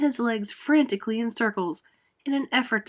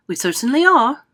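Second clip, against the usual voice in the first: confident answer; 5 semitones higher, 9 dB louder than usual. Acted. Grasshopper answers.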